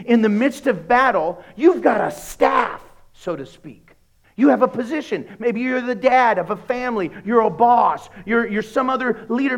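preaching at full force. Real, not acted. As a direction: drive it home to the congregation. Preaching builds.

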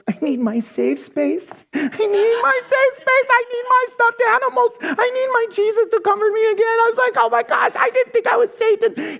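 very high, squealing whine; hammed-up panicky falsetto; mock meltdown. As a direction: wail like an upset little child. Imagines own reaction to rebuke.

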